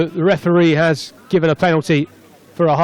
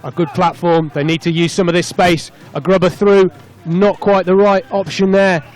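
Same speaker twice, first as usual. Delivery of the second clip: raised pitch, building as the attack breaks away; excited play-by-play; 5 semitones higher than usual. Real, not acted. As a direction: call the breakaway try live, voice lifted. Live play-by-play of try.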